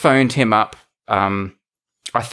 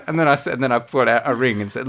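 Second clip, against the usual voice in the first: ordinary talk, nothing marked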